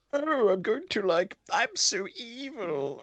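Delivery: pantomime villain. Retold or imitated. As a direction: sneer it like a cartoon baddie with a curly mustache. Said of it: "he does this fucking pantomime shit".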